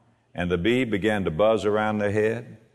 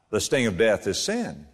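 ordinary delivery

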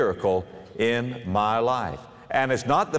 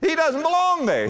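preaching emphasis. Real, not acted. Preacher's emphatic sermon point.

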